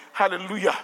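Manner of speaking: ordinary talk, nothing marked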